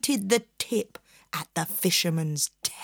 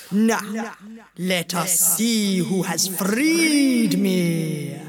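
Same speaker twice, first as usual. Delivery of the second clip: booming genie; slower than usual. Acted. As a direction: boom it out like thunder. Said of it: "The genie's voice boomed like thunder".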